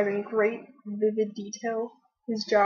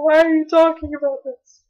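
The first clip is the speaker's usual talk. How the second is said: higher, loud imitation of appalled onlookers; mock horrified; 5 semitones higher, 10 dB louder than usual. Imitating the people in the row behind, mock horrified. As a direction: voice their horror in a raised, incredulous protest. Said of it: "were absolutely horrified".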